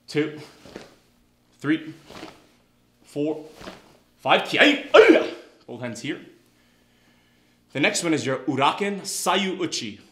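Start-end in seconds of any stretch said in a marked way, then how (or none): 4.5-5.3 high, very loud karate yell; kiai shout. Real, not acted. Instructor ending back fist drill, kiai shout. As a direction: let rip a kiai.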